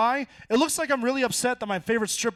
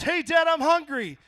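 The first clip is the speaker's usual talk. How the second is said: put-on whiny kid voice; 8 semitones higher, 5 dB louder, slower than usual. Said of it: "if my kid walks in and goes".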